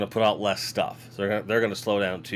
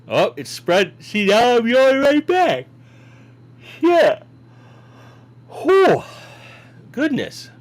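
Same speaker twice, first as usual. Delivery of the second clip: much higher and louder, yelping in alarm; startled yelps; 13 semitones higher, 9 dB louder, slower than usual. Real, not acted. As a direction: yelp at the mess, then a relieved whew. Reacting to a spill, yelping.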